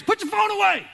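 high, loud coach bark at players; coach yell. Retold or imitated. Coach yelling at players to put phones away, loud bark. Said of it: "yelling at players".

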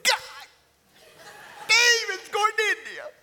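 high, loud, booming prayer to God; imitating loud prayer. Dad at the airport gate, praying aloud for his son, loud booming prayer. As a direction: throw your head back and call it out to heaven. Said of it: "Dad's prayers were always loud".

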